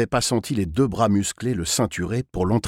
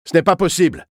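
loud, raised-pitch kidnapper voice; loud refusal; 8 semitones higher, 7 dB louder, faster than usual. A narrator's reading. Kidnapper answering the begging child, loud refusal. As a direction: shut down the plea forcefully. Kidnapper snaps back at pleading boy.

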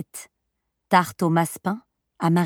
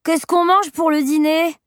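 put-on high little-boy question; petit garçon; 9 semitones higher, 4 dB louder than usual. A narrator's reading. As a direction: ask it like a hungry child. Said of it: "interrogea le garçonnet en mordant dans le pain". (voix aiguë de garçonnet)

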